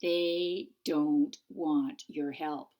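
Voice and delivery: very slow and deliberate; said slowly. Acted. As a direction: spell it out for a stubborn friend. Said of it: "let me say this slowly".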